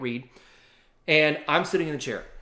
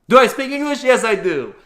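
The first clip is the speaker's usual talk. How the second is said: put-on much higher, louder French-accented English; loud, high French-accented reply to the tourist; 8 semitones higher, 9 dB louder than usual. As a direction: play the amused French nurse. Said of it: "he had this little funny accent".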